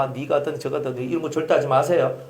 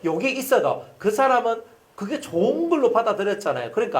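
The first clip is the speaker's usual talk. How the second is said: ordinary talk, nothing marked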